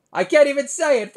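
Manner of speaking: high, loud exasperated cry; mock frustration. Comic failing to say a word, high exasperated squeal. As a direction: throw up your hands, squealing. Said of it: "I'm stuttering".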